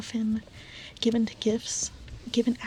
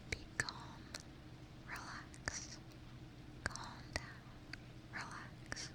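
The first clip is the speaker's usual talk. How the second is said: very soft, far-off whispered relax; ASMR trigger words, whispered very softly; close-up trigger words; 18 dB quieter, slower than usual. Pretend. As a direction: breathe each relax right into the mic. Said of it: "Some close-up trigger words?".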